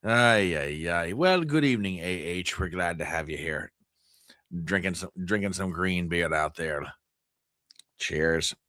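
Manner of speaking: a half-hearted Scottish brogue; Scots accent. Pretend. Host trying a Scots impression for viewers, a light brogue. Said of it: "enough Guinness in me to do a Scots".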